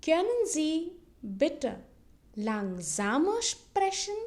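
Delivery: slow German, pausing after "bitte"; slow model phrase. Shown as a demonstration. Teacher models a polite request for learners, slowly in chunks. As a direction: draw out "Können Sie bitte langsamer sprechen" in small chunks. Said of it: "now let's say it in German".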